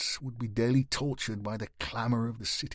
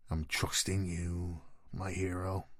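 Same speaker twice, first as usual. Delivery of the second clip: ironic quiet; 5 semitones lower, 6 dB quieter than usual. Acted. Knight's ironic quiet reply.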